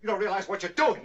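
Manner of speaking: high urgent plea; alarmed shout. Acted. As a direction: cry out in alarm. Visitor pleads for the gun.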